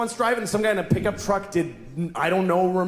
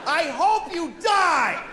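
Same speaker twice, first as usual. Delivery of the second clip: high, slow shout; shouted curse; 10 semitones higher, slower than usual. Retold or imitated. Driver shouting at another car, high slow shout. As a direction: wish death on him at full voice. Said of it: "I said that to a person".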